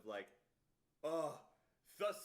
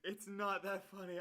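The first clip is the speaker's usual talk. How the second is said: slow, giggling through the words; real laughter in the voice; laughing; 4 semitones higher than usual. Real, not acted. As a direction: try to stop laughing and fail. Laughing at scam line.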